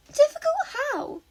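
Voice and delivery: put-on curious high girlish voice; Himiko's voice. A narrator's reading. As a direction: pitch way up for the playful girl.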